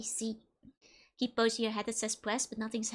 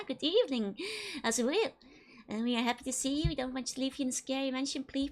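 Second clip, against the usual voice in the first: normal talking voice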